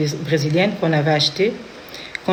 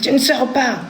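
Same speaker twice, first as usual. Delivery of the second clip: high, flat order, mock stern; lightly voiced stern husband; insisting husband; 8 semitones higher than usual. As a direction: imitate him forbidding her to leave. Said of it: "il insiste".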